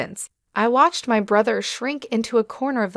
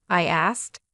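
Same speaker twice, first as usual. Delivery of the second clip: ordinary delivery, nothing marked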